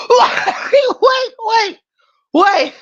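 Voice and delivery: high shrieks through laughter; laughing shouts. Real, not acted. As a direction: beg for a pause while cracking up. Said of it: "wasn't gonna be the only".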